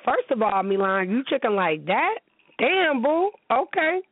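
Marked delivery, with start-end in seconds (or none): 2.6-4.0 sassy reaction. Pretend. Mock reaction to boyfriend paying.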